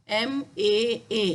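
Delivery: "M A A." sounded slowly letter by letter; slow spelling of "M A A" for learners; spelled out. Shown as a demonstration. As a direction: break the syllable into its parts, slowly. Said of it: "macam mana nak sebut?".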